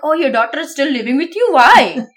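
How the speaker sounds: mock scolding in an Indian accent. Gossips asking parents about a daughter still at home, Indian accent. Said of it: "I am allowed to make an Indian accent".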